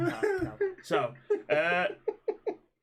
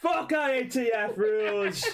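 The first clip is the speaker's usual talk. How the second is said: real frustrated shout, slow and loud; 6 semitones higher, 5 dB louder, slower than usual. Real, not acted. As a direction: vent at the rules you hate. Frustrated outburst at rules.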